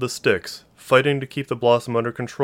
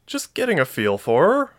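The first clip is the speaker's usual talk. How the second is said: high-pitched defensive reply; insistent, higher-voiced excuse; insisting; 7 semitones higher, 3 dB louder than usual. A narrator's reading. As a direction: protest it with a raised pitch. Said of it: "Marie insisted".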